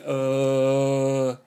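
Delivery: loud held hum over the buzz; voiced drone hum. Shown as a demonstration. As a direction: hold one loud steady hum. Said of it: "I'm just going".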